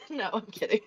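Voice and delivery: ordinary delivery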